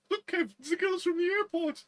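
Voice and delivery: high-pitched excited nudge; excited mate. Retold or imitated. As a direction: squeal it out to your mate. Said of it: "and he's like".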